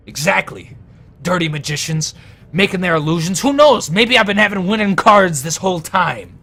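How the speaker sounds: loud, high outburst building to a shout; angry ranting. Acted. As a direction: rant like a cheated card player. Gambler rails at suspected cheat.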